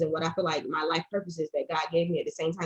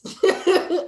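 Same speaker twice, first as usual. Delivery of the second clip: high, loud burst of laughter; 12 semitones higher, 8 dB louder than usual. Real, not acted. Joking to a friend about turning thirty, laughing. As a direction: laugh out at herself.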